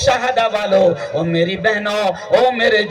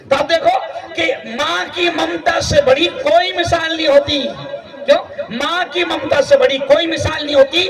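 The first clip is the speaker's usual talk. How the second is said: impassioned sermon shout; fiery preaching; 6 semitones higher than usual. Acted. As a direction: rouse the congregation. Preaching at full force.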